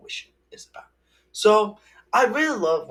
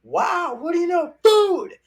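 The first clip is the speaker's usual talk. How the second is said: high, loud excited RJ voice; imitating RJ; 8 dB louder than usual. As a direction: shout it like the thrilled raccoon. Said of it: "R J says".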